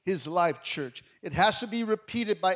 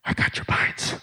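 gasping, unvoiced, out of breath; breathless whisper. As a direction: wheeze it out after running up the stairs. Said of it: "I was like this".

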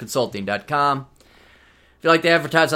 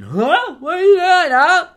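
higher, louder mock indignant questions; 13 semitones higher, 7 dB louder, slower than usual. Needling friend Craig about the hundred-dollar fee, mock indignant and loud. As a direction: hammer him with a high, loud "huh".

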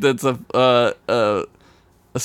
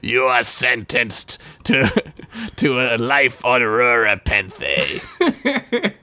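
very slow, drawn-out sentencing; halting, ceremonial verdict; mock tribunal judge; much slower than usual. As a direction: pronounce sentence with mock gravity.

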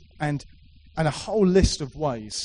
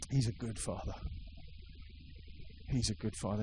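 1 soft repetition; 4 semitones lower, 12 dB quieter, faster than usual. Real, not acted. Preacher repeats softly for effect.

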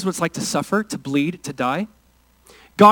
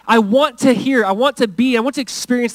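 lightly voiced, higher and louder longing; earnest raised plea; Elijah; 7 semitones higher, 4 dB louder than usual. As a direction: plead to experience God, voice raised. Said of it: "Elijah seeing the wind and the fire".

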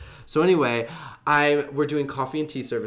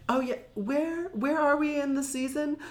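a hint of a breezy host not keeping track; lightly voiced airy host; 10 semitones higher, 6 dB quieter than usual. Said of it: "Rue was so funny".

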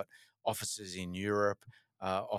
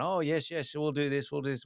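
higher, sugary mock-polite agreement; overly polite Londoner; 7 semitones higher than usual. Mocking London colleagues in meetings, higher, overly polite agreement. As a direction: imitate the too-nice colleague agreeing to everything. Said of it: "so polite to each other and so nice to each other".

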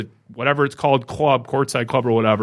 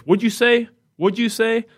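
higher, pushy imitation of a hostile usher; mock confrontational usher; 10 semitones higher than usual. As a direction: throw it back at him like a challenge, hammed up. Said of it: "and he says".

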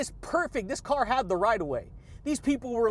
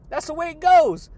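louder and much higher, falling at the end; belted catchphrase; 7 semitones higher, 10 dB louder than usual. Real, not acted. Vlogger belting his catchphrase to viewers. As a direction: belt it like a sign-off.

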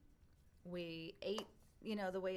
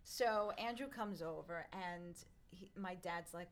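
ordinary talk

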